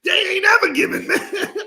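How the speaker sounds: high, fast, laughing shout; joking exclamation. Pretend. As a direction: squeal the punchline through laughter. Repeats own joke, high and laughing.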